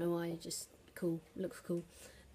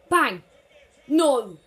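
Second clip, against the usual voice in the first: sudden yell, much louder; loud outburst; 5 semitones higher, 16 dB louder than usual. Real, not acted. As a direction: shout as the shot is stopped. Shot saved in game.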